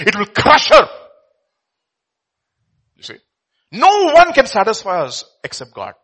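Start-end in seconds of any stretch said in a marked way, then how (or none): none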